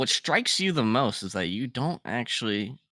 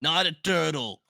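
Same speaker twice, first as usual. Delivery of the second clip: exasperated skull; 6 semitones higher, 4 dB louder than usual. Acted. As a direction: correct them with offended emphasis. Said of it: "that's so much better".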